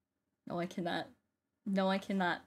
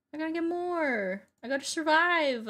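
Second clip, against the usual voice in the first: pleading resolve; 6 semitones higher than usual. Real, not acted. Straining to beat record.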